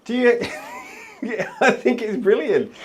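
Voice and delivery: amused, giggling reply; laughing. Real, not acted. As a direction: chuckle while saying it's brilliant. Laughing at hobby horse idea.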